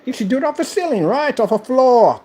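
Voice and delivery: rising challenge. Real, not acted. Building challenge, high pitch.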